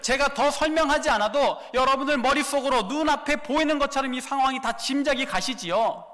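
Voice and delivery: usual speaking voice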